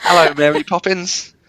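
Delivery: high-pitched caricature British voice; hammed-up British accent. British co-host clowning with film lines, exaggerated British accent. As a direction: ham up a high, silly British character. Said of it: "Doing my, doing my Harry Potter again".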